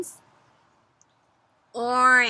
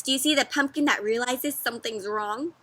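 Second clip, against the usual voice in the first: ordinary delivery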